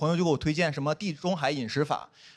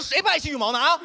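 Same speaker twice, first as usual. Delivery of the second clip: mock anger; 7 semitones higher than usual. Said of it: "我当时就火".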